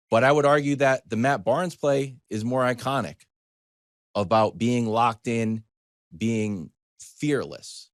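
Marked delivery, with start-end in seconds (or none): none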